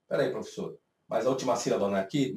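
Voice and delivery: low, hushed pupil interjection; imitated student objection. Student interrupts the teacher about the last syllable, hushed mock objection. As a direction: lightly voice a student cutting in quietly.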